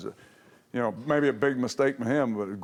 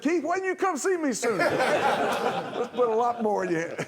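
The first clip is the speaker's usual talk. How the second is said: laughing tease; 9 semitones higher than usual. Real, not acted. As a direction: rib him while cracking up. Teasing Keith, laughing.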